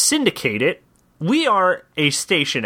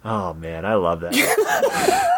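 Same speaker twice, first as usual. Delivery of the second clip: delighted; 7 semitones lower, much slower than usual. Real, not acted. Delighted reaction to pitch.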